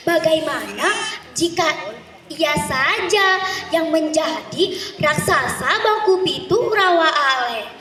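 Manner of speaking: ordinary delivery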